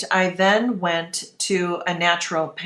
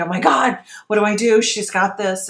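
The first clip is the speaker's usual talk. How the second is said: panicked inner voice; 3 semitones higher, 5 dB louder than usual. Retold or imitated. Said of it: "in my mind".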